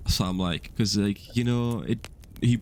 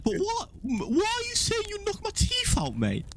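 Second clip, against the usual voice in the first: very high-pitched mocking mate voice; Dudley impression. Friend retells his mate's challenge about knocking teeth out, high-pitched impression. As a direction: imitate a friend in a squeaky high voice. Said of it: "That's how he talked".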